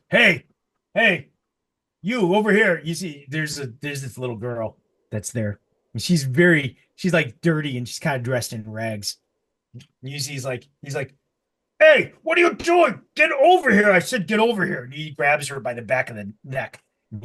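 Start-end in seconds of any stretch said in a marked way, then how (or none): 0.1-2.8 calling out. Acted. Orc calling out to girl. 11.8-14.8 put-on loud, high barking orders at a child; furious merchant shouting across the yard; angry shout. Orc shouting at the girl.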